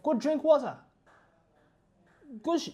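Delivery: normal talking voice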